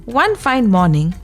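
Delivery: ordinary delivery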